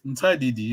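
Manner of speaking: "Ntadidi" said with the N barely sounded; silent N. Shown as a demonstration. Teacher correcting student, "Ntadidi" without a sounded N. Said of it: "you don't read the N".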